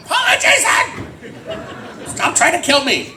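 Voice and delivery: high-pitched startled mock scream; panicked high squeal, playing it for laughs; startled outcry. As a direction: shriek it like you nearly got killed. Retold scream of startled co-star.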